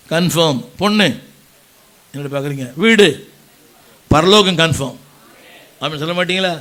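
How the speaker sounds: ordinary talk, nothing marked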